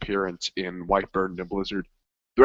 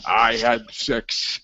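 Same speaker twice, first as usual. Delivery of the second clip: deadpan slow line; 3 dB louder, much slower than usual. Retold or imitated. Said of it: "he's like".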